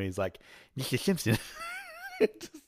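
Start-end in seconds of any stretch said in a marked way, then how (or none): 0.7-1.4 Mister Simpson with a lisp, s as sh. Retold or imitated. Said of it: "I love the lisp that the guy".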